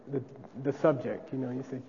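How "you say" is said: ordinary talk, nothing marked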